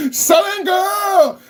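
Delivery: high, drawn-out mocking yell; slow, high jeering shout at a chatter. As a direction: bellow it slowly to mock him.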